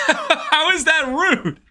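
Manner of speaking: high, slow, laughing how is that rude; real laughing incredulity; laughing disbelief. Real, not acted. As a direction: laugh through the question in disbelief.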